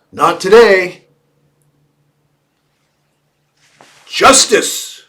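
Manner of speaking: loud, high-pitched shout; mock battle cry. Mock cry against hippie love.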